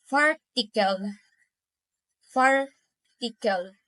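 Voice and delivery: "Farticle" split as far, pause, ticle; syllable by syllable. Shown as a demonstration. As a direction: detach the first syllable of "Farticle". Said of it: "I'm pronouncing it by syllables".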